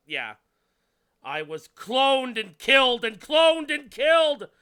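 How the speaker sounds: rising to a frantic shout; hammy character voice. Acted. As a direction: build from upset into a screaming rage. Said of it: "she's just like".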